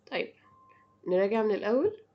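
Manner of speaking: normal talking voice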